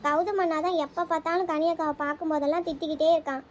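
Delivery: usual speaking voice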